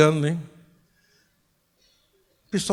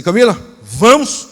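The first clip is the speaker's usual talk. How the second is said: urgent, pressing shout to get going; loud urgent call; 2 semitones higher, 11 dB louder than usual. Retold or imitated. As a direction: call out "Camila, vamos!" much louder. Urging wife to leave now. (chamado alto e urgente)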